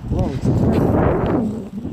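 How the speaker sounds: slow, loud, high amazement; mock amazement. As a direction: marvel at it slowly.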